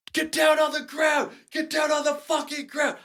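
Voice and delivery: high, strained cop shout held back in volume; imitated police yell. Cop ordering suspects down outside the van, mock yelling. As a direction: bellow orders as if from a distance. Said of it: "who just yelled th-, that at you?".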